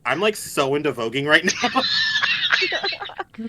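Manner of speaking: put-on slow, drawn-out valley-girl voice; ironic zoomer. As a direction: stretch it out like a bored teen. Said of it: "see this GSA and be like".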